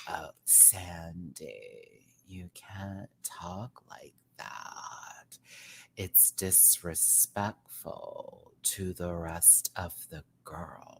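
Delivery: slow, soft whispered mock scolding; snooty cartoon teen voice. Vlogger imitating a Daria character, slow soft whisper. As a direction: hush it out slowly like a prissy fashion-club girl. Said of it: "Come on, get the voice".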